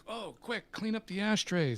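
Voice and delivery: high, hurried alarm; mock panic. Narrator telling the engineer to hide the ashtrays, high and panicky. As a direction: scramble before he arrives.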